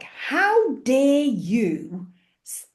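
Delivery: slow, high, weighty rebuke; controlled anger. Retold or imitated. Jesus rebuking traders in the temple, slow controlled anger. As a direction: drag out each word with held-back fury. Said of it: "he was anger under control".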